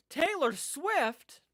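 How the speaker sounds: high, loud, drawn-out exclamation; mock shock. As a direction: cry it out as an incredulous reveal. Joking reveal of the killer.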